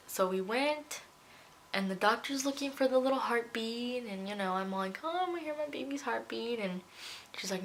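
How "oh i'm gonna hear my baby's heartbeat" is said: high, quick retold excitement; eager anticipation. Mother-to-be awaiting the first heartbeat, eagerly excited. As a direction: rush it lightly, pitched up.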